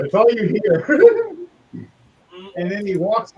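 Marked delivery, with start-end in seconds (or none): 0.9-1.5 high, loud laugh. Real, not acted. GM laughing at the slap he narrated, high and loud. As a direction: laugh at your own scene.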